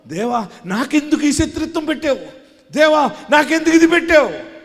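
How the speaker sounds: believer's loud plea to God asking why; anguished complaint to God. Acted. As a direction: cry out why me to heaven. Acting a complaining prayer to God.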